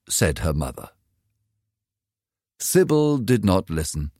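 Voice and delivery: ordinary delivery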